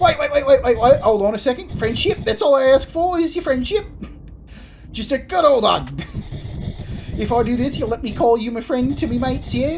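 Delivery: high, excited Antipodean-Cockney voice; postman Creed, eagerly pleading. Acted. Creed begging the player for friendship, high and eager.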